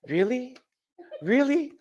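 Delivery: high, incredulous Really?; squeaky double take. Retold or imitated. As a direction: leap up in disbelief. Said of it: "I said".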